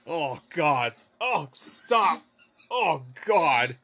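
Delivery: loud, pleading groans of protest; loud recoiling exclamations; repulsed outcry. Real, not acted. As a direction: cry out, begging it to end. Recoiling from the clip being watched.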